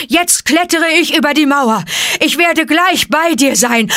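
high, loud, out-of-breath bear yelling; climbing and calling to his friend; breathless bear bellowing; panting shout. A narrator's reading. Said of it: "schrie er keuchend".

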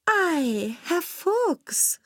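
very high cat call; high, singsong call. A narrator's reading. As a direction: cry out as the cat, drawn out and high. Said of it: "rief die Katze".

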